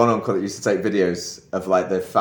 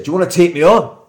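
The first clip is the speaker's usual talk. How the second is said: loud, high Glaswegian confrontation; imitated Glaswegian challenge, raised and punchy; 9 semitones higher, 9 dB louder than usual. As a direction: square up in a mock Glasgow accent. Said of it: "I thought he said".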